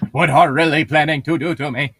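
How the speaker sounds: mocking. Mocking the misread line.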